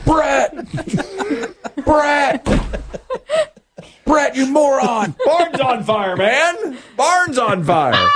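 mock-frantic goose calling Brett awake; goose voiced as a person. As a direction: shriek it like a frantic goose trying to wake him. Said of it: "That's an actor's recreation".

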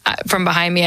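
usual speaking voice

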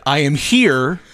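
slow deliberate repeat. Retold or imitated. Repeated slower to confused man.

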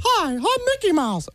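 cartoon mouse introducing himself; Mickey Mouse. Guest warms back into the character for hosts, cartoon mouse voice. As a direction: greet them in a cartoon mouse voice. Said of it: "say the first line again to get me back".

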